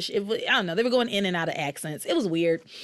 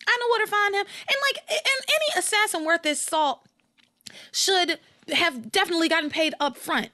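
excited assassin rant; 8 semitones higher than usual. Pretend. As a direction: argue it like you're the hitman yourself. Pretends to be the assassin.

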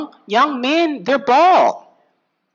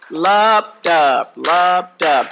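heartbeat sounds; 4 semitones lower, much slower than usual. Shown as a demonstration. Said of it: "I want to really show you".

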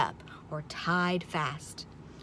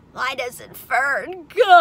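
loud, slow wail breaking into sobs; drawn-out tearful plea; crying piglet; 6 semitones higher, 9 dB louder, much slower than usual. A narrator's reading. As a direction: start bawling like a little pig. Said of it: "He began to cry".

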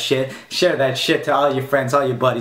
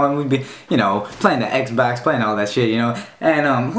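normal talking voice

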